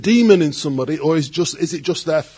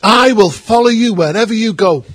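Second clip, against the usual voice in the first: eager vow; 5 semitones higher, 7 dB louder than usual. Retold or imitated. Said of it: "someone said to Jesus".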